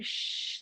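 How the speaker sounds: imitando um "shh" sussurrado, sem voz; faça o chiado de quem pede silêncio; "shh" para acalmar. Retold or imitated. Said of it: "mandou ficar quieta". (chiado suave pedindo silêncio)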